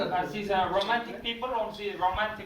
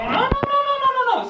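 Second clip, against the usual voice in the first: very high, louder frantic protest; shrill-pitched mock panic, much higher and louder; fussy customer squeal; 6 dB louder, much faster than usual. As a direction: squeal like a picky diner. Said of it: "And they go".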